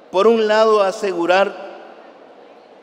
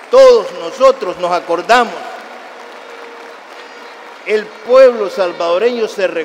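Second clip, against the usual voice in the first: louder, rousing the crowd's memory; loud rally call; 6 dB louder than usual. Real, not acted. Speaker to party comrades, recalling the confession, loud and rousing. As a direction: call it out to the whole room.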